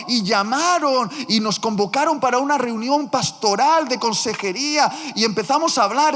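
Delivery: usual speaking voice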